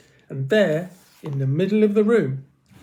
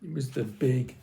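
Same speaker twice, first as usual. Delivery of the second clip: plain reading voice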